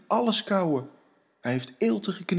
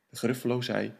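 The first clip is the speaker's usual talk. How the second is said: ordinary delivery, nothing marked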